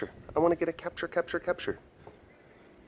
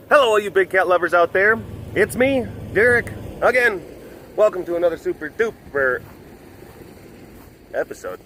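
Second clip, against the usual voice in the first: host greeting; 5 semitones higher, 3 dB louder than usual. Acted.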